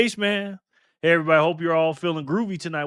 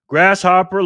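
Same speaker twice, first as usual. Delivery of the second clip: loud greeting; 4 semitones higher, 10 dB louder, slower than usual. Real, not acted. Greeting a viewer by nickname.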